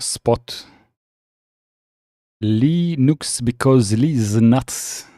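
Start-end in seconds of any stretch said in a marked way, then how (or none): 2.4-3.4 Linux said LEE-nooks, first syllable held long; Linux with a long, raised "lee"; stretched pronunciation. Shown as a demonstration. Showing pronunciation from chat.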